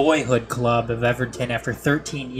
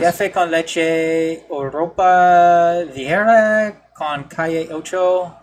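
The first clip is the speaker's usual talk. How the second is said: slow, higher, deliberate Spanish pronunciation; careful, drawn-out Spanish with an American tongue; Spanish accent attempt; 4 semitones higher, 4 dB louder, much slower than usual. Shown as a demonstration. Co-host showing colleagues his shaky Spanish, exaggerated Spanish accent.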